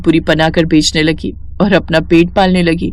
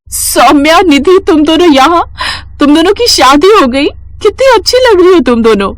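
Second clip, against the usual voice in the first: much higher, delighted reunion cry; light up at seeing old friends; joyful surprise; 9 semitones higher than usual. Acted. Reena greeting her married school friends, overjoyed and higher.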